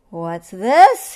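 very loud lion's question; roared. A narrator's reading. Lion finds a bed in the jungle, roared. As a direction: boom it out in surprise. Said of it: "he roared".

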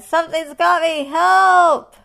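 high, panicked shouting; roared. A narrator's reading. Lion grabbed from above, roared. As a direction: yell it all out, building to the final cry. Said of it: "roared the lion".

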